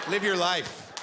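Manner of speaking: ordinary delivery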